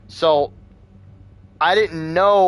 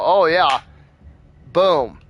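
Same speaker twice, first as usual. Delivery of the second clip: mocking doctor; 3 semitones higher, 5 dB louder, slower than usual. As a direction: toss it off like a careless ER doctor. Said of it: "point the flashlight in my face and go".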